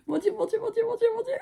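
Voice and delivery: aigu, soufflé, incrédule; mon Dieu ému. Real, not acted. Overwhelmed, laughing disbelief. (stupeur émue et rieuse)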